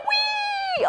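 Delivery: very high sliding squeal; slipping down the table leg; high playful whee. Acted.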